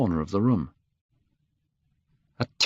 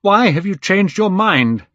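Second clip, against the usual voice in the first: much higher, louder demanding cry; 11 semitones higher, 7 dB louder than usual. Acted. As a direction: demand it in panic, loud. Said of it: "he cried".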